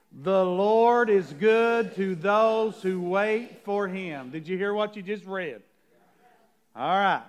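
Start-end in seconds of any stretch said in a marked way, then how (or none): 0.1-4.3 very slow, drawn-out reading; take our time. Shown as a demonstration. Pastor restarting the verse with the church, very slowly. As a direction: stretch "The Lord is good to those who wait" out slowly. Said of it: "We're gonna take our time".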